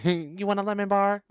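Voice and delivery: mock-sweet Betty Crocker offer; sugary sweet offer. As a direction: offer the lemon bar sweetly. Voicing sweet offer in scene.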